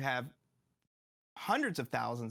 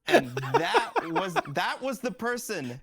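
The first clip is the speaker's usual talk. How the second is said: laughing; 10 semitones higher, 9 dB louder, slower than usual. Real, not acted. Laughing while telling punchline.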